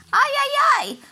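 high, loud squawking ajajaj; shocked crow squawk; crow dismay. A narrator's reading. Crow's dismay.

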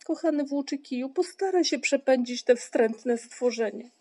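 high-pitched plaintive pleading; plead, feeling sorry for yourself; aggrieved, whining appeal; complaining. A narrator's reading. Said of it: "żalił się Paszczak".

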